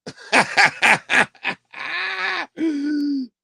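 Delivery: a long, high peal of laughter; real laughter after the punchline. Real, not acted. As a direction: let the laugh roll on, high.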